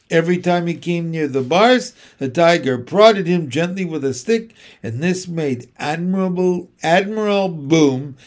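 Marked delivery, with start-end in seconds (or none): none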